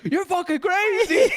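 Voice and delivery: high, laughing outburst; guy stunned by the crash, laughing; laughing disbelief. Retold or imitated. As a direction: crack up while quoting him. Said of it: "he's just like".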